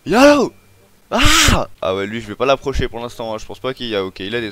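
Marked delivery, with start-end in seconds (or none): 0.1-0.5 very high, loud alarm cry; high-pitched startled yell; panicked yelp. Real, not acted. Repeats wolf alarm higher. 1.1-1.7 high, loud yelp; short scream of alarm; sudden panicked cry; startled scream. Real, not acted. Scream at the wolf.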